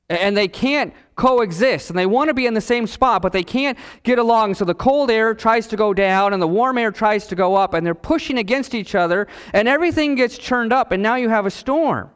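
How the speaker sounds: impassioned preaching. Real, not acted. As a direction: preach it urgently, piling clause on clause. Preaches the storm illustration building up.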